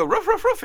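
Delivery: high yappy barks, imitating a dog; dog barking. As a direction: bark it out like Lassie. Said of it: "Lassie would go".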